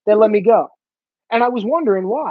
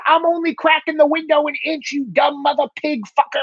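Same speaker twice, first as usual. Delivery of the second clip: mild mock yell; 7 semitones higher than usual. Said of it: "the guy starts screaming back".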